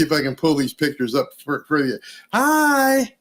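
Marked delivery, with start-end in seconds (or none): 2.3-3.1 high, bright little hi; a girl waving hopefully; hopeful girl's hi. Retold or imitated. The girl greeting Biden, high and hopeful.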